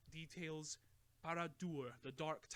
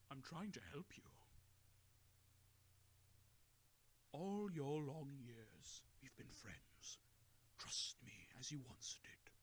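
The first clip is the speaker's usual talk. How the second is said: gentle plea; 5 dB quieter than usual. A narrator's reading. Gandalf gently pleading with his old friend Bilbo, soft. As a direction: coax him back tenderly.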